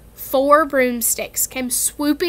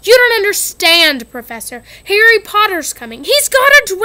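higher, louder pleading whine; desperate Malfoy; 8 semitones higher, 9 dB louder than usual. Acted. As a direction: beg to be believed.

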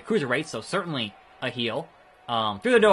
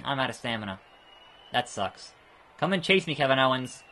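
ordinary talk

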